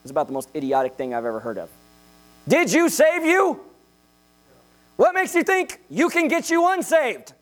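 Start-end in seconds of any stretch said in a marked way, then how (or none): 2.5-7.3 stretched, high-pitched rhetorical challenge; incredulous preaching. Acted. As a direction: preach it as an exasperated question.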